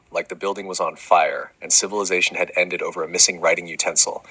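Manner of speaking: normal talking voice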